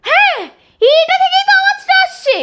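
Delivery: high, astonished exclamation; startled discovery. Acted. Parent finds the voice's source, startled.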